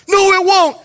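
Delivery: high, urgent contradiction; panicked protest. Retold or imitated. Kid arguing with his reassuring pastor, panicked protest. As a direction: imitate the kid refusing to be soothed.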